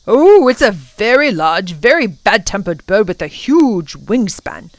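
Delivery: loud opening with big swooping stresses; emphatic professor. Acted. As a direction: describe it with relish and dread. Professor describes the bird.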